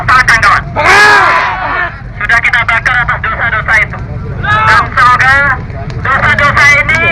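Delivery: rally speech. Acted. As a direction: whip up the crowd, building. Rally orator addressing crowd. (orasi demo yang membakar semangat)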